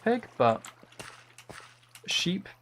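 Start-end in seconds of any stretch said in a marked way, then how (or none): 2.1-2.5 sheep impression. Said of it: "making me do loads of impressions".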